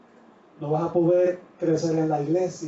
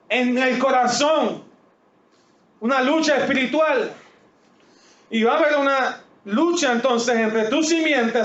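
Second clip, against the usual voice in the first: higher, emphatic preaching voice; 7 semitones higher than usual. Real, not acted. Pastor proclaiming the struggle to the congregation, fervent. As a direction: proclaim the spiritual battle with fervor.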